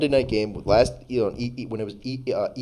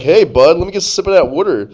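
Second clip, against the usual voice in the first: loud, quick, raised-pitch stadium buddy; loud friendly fan; 6 semitones higher, 9 dB louder than usual. Acted. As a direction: call out to a fellow fan, higher and louder. Acted stadium stranger asking for sip.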